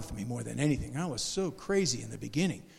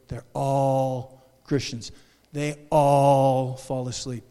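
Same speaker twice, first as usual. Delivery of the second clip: slow emphasis; 3 semitones lower, 4 dB louder, much slower than usual. Real, not acted. Preacher stressing all slowly.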